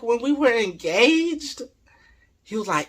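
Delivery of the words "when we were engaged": high, drawn-out imitation of disbelief; incredulous wife. Wife confronting her husband over cheating, incredulous. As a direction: question him slowly in a high voice.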